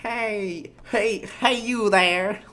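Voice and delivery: high, drawn-out call; trying out an old person's holler; lightly voiced old person calling out; attempted old person. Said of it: "How do you do an old person?".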